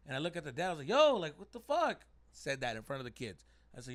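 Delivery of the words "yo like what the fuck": loud, high, slow indignant challenge; snapped outburst. Retold or imitated. Snapped dad cussing at the other dad, raised angry voice. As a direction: challenge him hotly, voice raised. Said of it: "like, snapped".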